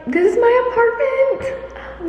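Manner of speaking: very high, drawn-out squeal of joy; real giddy, high-pitched delight; excited squeal. Real, not acted. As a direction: squeal it slowly in happy disbelief. Overjoyed at new apartment.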